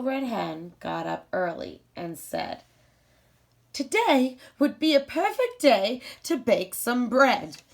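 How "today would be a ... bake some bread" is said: higher, louder bright hen voice; the little hen announcing a baking day; cheerful hen. Acted. Hen plans to bake bread.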